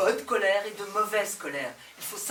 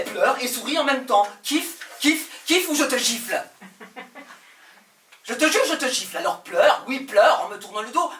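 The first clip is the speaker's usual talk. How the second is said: higher, louder, begging through fury; desperate angry shouting; 4 semitones higher, 9 dB louder than usual. Acted. Pleading with a tired lover, loud and furious. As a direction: shout the pleas until they crack into threats. Escalating plea to a weary partner.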